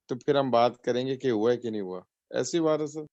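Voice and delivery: ordinary talk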